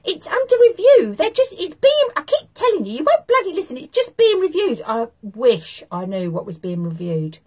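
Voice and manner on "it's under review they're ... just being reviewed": loud, high squeaky nagging; shrill it out, exasperated; squeaky angry woman. Retold or imitated. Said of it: "she kept saying".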